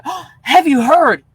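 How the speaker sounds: loud, slow, showy gossip. Retold or imitated. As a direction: play to the whole checkout line. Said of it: "not in my normal tone of voice, just a little louder".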